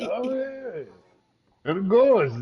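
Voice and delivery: high, slow mock Japanese accent; hammy Japanese accent voice. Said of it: "my Japanese accent".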